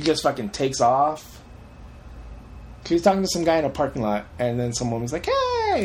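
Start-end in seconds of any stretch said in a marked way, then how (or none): 5.2-5.8 very high, sing-out greeting; high-pitched calling out. Retold or imitated. Film woman calling to a guy in a parking lot, high imitated call.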